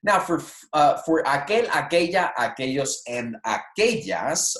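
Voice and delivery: ordinary talk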